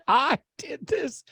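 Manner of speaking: put-on very high, slow, smug drunk boast; proud boast. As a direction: crow it like a proud drunk alpha. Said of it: "He's like".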